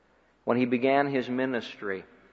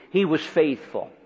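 ordinary talk, nothing marked

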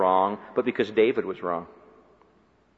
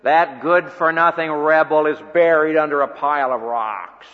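scornful; 3 semitones higher, 6 dB louder than usual. Retold or imitated. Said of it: "what some people would have said".

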